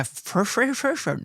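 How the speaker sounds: tongue tripping on the word; stuck stuttering on a word; stumbling speech. Real, not acted. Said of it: "I still can't speak".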